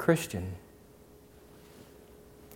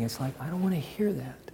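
soft whispered aside; 3 semitones higher, 6 dB quieter than usual. Acted. Pastor rejecting begging prayers, whispered aside. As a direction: murmur it under your breath.

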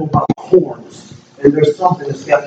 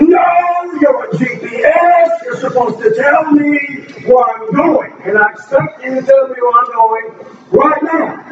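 raised, pitched-up exasperated plea; frustrated at the GPS; 9 semitones higher than usual. Retold or imitated. Driver pleading with his lost GPS, exasperated and high. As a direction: scold the GPS like it let you down.

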